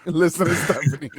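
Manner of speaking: amused echo through laughter; giggling through the words; laughing. Real, not acted. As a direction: laugh as you repeat it. Laughing while echoing the co-host.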